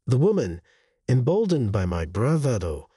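plain reading voice

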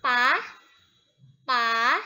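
pa loud, rising; second tone pa. Shown as a demonstration. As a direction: sound pa with a rising tone. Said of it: "Jadi bacanya seperti apa?". (pa dengan nada kedua)

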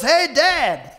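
put-on high, loud kid calling to Dad; loud, high-pitched child impression; imitating his daughter. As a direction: call out like an eager daughter. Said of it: "and she goes".

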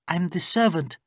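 high female character voice; reassuring servant woman. Acted. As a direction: answer the captive simply, pitched high. Layla answers captive.

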